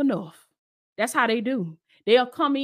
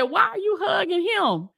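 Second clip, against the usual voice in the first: put-on girl's voice; 7 semitones higher than usual. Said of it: "she said".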